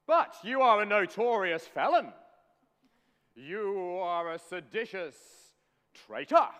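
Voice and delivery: loud, high burst of royal outrage; kingly denunciation. Pretend. King Henry condemning his prisoner as a traitor, loud and outraged. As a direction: thunder the accusation, drawing out the insults.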